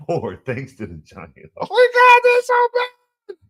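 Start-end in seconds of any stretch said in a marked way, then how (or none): 1.6-3.4 high-pitched loud mock dismay; high squeal of disgust. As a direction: shriek it like the team hurt you. Groaning over the Giants' loss.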